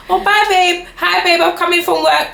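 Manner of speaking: high, bright mock chatter of the girlfriend; chatty girlfriend. Teasing how girlfriend chats away, in a mock high chatty voice. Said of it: "She will be talking all the time".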